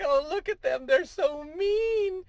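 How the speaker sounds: very high, slow falsetto mock whine; hammed-up high whiny protester; mock whiny liberal. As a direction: whine so mean in a silly falsetto, dragging it. Mocking opponents' voice.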